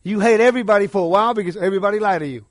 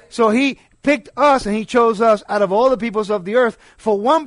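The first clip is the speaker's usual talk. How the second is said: fervent louder preaching; preaching at full force; 3 semitones higher, 4 dB louder than usual. Acted. Pastor preaching to his congregation, fervent and louder. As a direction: proclaim it to the congregation.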